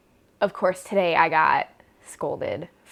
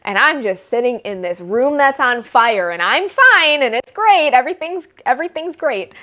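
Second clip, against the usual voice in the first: high, loud forced cheer over chaos; sarcastic this-is-fine; 6 semitones higher, 6 dB louder than usual. Real, not acted. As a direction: chirp that everything is great while it burns.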